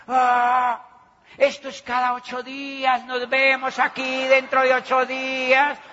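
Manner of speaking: loud, high announcer cheer; mock host hype. Host closing the meeting, hyped and loud.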